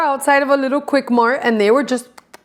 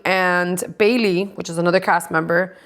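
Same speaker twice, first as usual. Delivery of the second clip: normal talking voice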